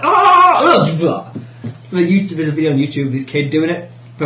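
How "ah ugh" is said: high, loud mock yell and grunt; hammed-up "ah" then "ugh"; imitated waking cry. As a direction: act out coming round with a startled cry. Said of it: "and she's like".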